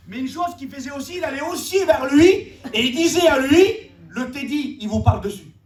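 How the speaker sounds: high-pitched, emphatic sermon delivery; impassioned preaching. Real, not acted. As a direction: preach it with rising urgency. Preaches the enemy's divisive words. (prédication enflammée)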